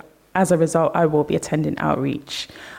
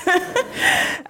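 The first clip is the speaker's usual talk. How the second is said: nervous laugh; 12 semitones higher than usual. Real, not acted. Nervous speaker laughs at slip.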